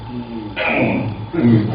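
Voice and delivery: 느리게 길게 끄는 낮은 "음" 힘주는 소리; 화장실에서 힘주는 소리를 흉내 내듯 "음"을 천천히 늘여라; imitated straining hum. Shown as a demonstration. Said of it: "화장실에서 뿅 보는 소리 어떻게 나올까요?". (화장실에서 힘주는 소리를 느리게 흉내 냄)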